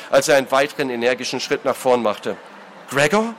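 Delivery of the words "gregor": high, rising query; irritated question. A narrator's reading. Brother turning to his brother, irritated and puzzled. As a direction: ask in baffled irritation. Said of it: "fragte Foster irritiert".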